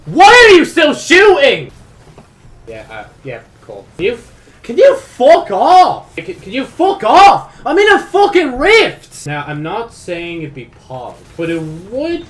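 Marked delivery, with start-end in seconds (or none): 0.1-1.7 much higher, much louder slow shout at the enemy; angry yell. Real, not acted. Enemy keeps shooting him. 4.0-9.3 much higher, much louder, slow drawn-out shouting; furious yelling. Real, not acted. Enemy interrupts his rift.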